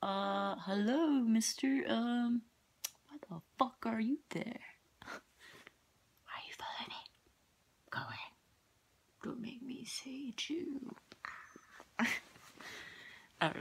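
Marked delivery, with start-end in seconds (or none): 0.0-2.4 nervous greeting. Pretend. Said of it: "And be like". 3.1-11.0 scared whisper. Pretend. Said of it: "And be like".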